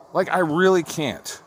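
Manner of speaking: ordinary talk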